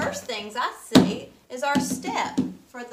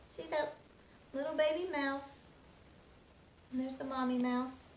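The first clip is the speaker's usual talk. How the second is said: usual speaking voice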